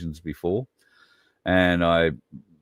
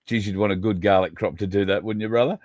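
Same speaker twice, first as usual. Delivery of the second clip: usual speaking voice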